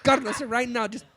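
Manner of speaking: high, loud, urgent mom voice; imitating his mom. Son mimics mom begging, high urgent pleading. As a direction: beg him like a frantic mother. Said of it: "And she's like".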